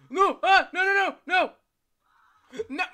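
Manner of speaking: very high, very loud no; desperate screaming. Real, not acted. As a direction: scream as it catches you. Caught by monster.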